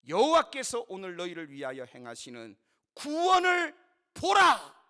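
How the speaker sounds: full-voiced shout rising to a peak; crying out. Moses telling the Israelites to behold salvation, shouted. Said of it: "이렇게 외쳤습니다".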